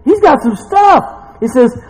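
loud, high sermon delivery; fired-up preaching. Real, not acted. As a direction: preach it with rising force. Preaching at full force.

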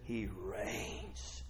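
very soft, low "he reigns"; hushed awe. Acted. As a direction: drop to a near whisper. Hushed take before excited repeat.

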